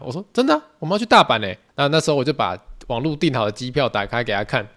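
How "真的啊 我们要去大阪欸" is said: excited announcement. Retold or imitated. Announcing the Osaka trip.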